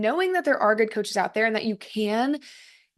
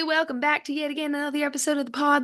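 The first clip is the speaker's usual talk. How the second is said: bad Southern accent; 5 semitones higher than usual. Podcaster opening in celebration of a Texas license, bad Southern accent. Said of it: "That was the worst Southern accent ever".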